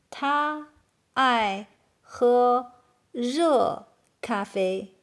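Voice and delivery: slow, drawn-out separate words. Shown as a demonstration. Teacher translating for Chinese learners, slowly, word by word. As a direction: pause between words for learners.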